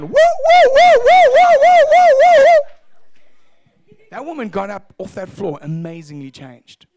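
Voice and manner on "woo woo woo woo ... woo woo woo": piercing high siren-like "woo"; loud falsetto whooping run; imitated wailing whoops. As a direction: mimic her at full blast, repeatedly. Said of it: "And she carries on".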